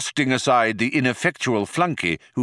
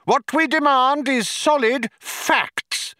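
bawling insistence. A narrator's reading. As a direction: hammer out each point in a high bawl. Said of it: "bawled Vroom Fondle, banging on a nearby desk".

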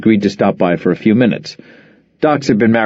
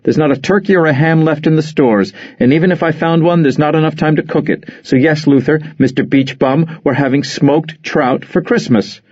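higher, exasperated wife voice; Nora firing back; 6 semitones higher than usual. Acted. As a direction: snap back at your husband, fed up. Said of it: "she fired back with glowing eyes".

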